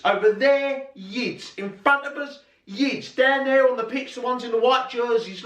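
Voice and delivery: retold dad, strained high as if over a crowd; high animated explaining. Dad pointing out fans to his child, high animated explaining. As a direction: voice pitched right up, reeling off examples.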